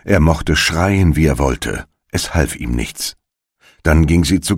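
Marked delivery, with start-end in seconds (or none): none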